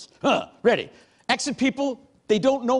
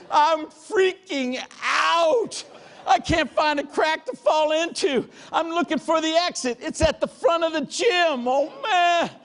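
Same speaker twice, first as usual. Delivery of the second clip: high, strained mock freak-out; hammed-up desperation, pitched high; comic panic; 8 semitones higher, slower than usual. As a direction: relive the panic in a raised pitch. Relives his panic in the gym.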